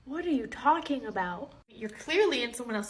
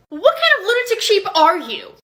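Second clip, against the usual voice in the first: angry yell; 6 semitones higher, 11 dB louder than usual. Acted. Angry parent attacks teacher.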